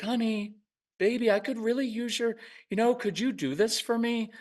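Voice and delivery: high, sugary pleading parent voice; mock a parent begging sweetly; too-sweet parent. Parents who are way too sweet, mock-pleading with their child. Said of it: "Now they're way too sweet".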